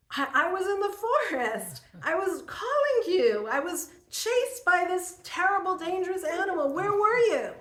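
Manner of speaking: high, loud mock-indignant child; upset little boy. Boy tells his father he was chased, upset reproach. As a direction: imitate a child's breathless-to-tell, high-pitched complaint.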